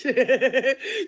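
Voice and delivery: short scornful laugh; mocking laugh. Real, not acted. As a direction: laugh her off. Mocking the auntie.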